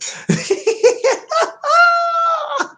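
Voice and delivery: real laugh breaking into a shriek; high shrieking laugh. Real, not acted. Cracking up at chat.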